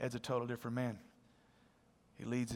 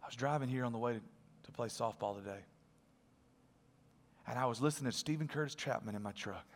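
hushed telling; 7 semitones lower, 10 dB quieter than usual. Retold or imitated. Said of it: "He said".

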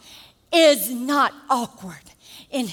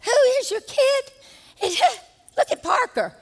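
mock indignant mom; 10 semitones higher than usual. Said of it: "And I'm saying".

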